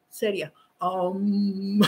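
low, soft self-composing; forced seriousness. Real, not acted. Host telling herself to stay serious, forced seriousness. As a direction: drop low and quiet to compose yourself. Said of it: "Tengo que estar seria".

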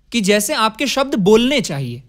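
normal talking voice

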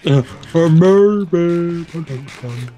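high, very drawn-out production credit; mock credits jingle. Player echoes the Milby credit, high drawn-out mock announcement. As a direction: stretch the credit out high and slow.

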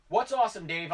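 answering Dave's question from across the room, loud; calling back. Real, not acted. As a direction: call back to a friend nearby.

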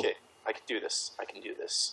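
hushed, fast self pep talk under his breath; muttering courage to himself, fast; psyching himself up. Real, not acted. Nervous player on his turn, hushed self pep talk.

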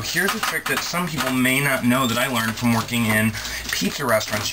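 usual speaking voice

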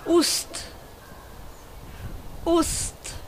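ost with a Swedish oo-like o. Shown as a demonstration. Teacher showing viewers the word ost, Swedish pronunciation. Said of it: "words that I should, uh, pronounce".

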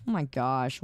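ordinary talk, nothing marked

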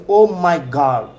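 everyday exclamation as model; hard g demo. Shown as a demonstration. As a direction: say God with a clear hard g. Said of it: "এভাবে করে বলি না?".

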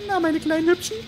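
mock sweet villain voice; creepy villain. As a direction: coo at the prey like a witch. Killer greets cornered survivors.

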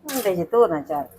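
low, soft murmur to herself; said half under her breath while busy; quiet mutter. Acted. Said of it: "ਕੀ ਕਰੀ ਜਾਨੀ ਆ ਭੈਣੇ?". (ਹੌਲੀ ਜਿਹੀ ਬੁੜਬੁੜ)

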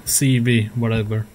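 ordinary delivery

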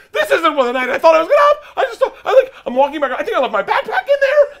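very high, loud comic panic; hammed-up hysterical yelling; freaking out. Comic replays himself fleeing a club fight, freaking out. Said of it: "Like, I'm freaking out".

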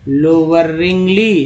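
लोअरिंगली stretched out slowly, syllable by syllable; slow word demo. Shown as a demonstration. Teacher spelling out लोअरिंगली slowly for students. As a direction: draw out लोअरिंगली slowly, landing on the final ली. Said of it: "एल वाई को ली बोलते हैं".

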